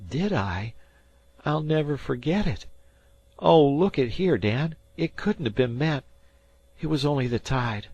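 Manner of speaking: put-on a rattled boy trying to calm himself; lightly voiced boy. A narrator's reading. Harvey to Dan, lightly voiced uneasy boy.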